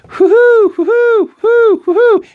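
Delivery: very high, loud whoops; gleeful whooping. Acted. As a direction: whoop like a giddy little guy. Johnny whooping as he runs.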